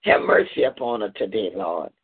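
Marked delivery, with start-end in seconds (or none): none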